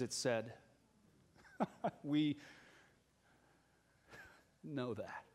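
normal talking voice

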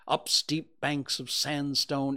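plain reading voice

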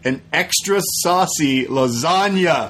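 slow, pointed "an extra saucy lasagna"; quoting the order slowly; reading out the receipt. Retold or imitated. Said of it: "and I quote".

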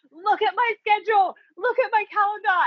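high, exasperated commands; frantic demand. Retold or imitated. Wife demanding husband check her calendar, high and frantic. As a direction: insist in a high panicked voice.

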